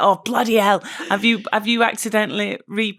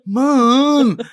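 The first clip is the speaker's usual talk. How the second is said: louder, higher mock of a fed-up teenager; exasperated daughter; 4 semitones higher, 6 dB louder, much slower than usual. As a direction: roll your eyes at mum. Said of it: "you can hear in her message".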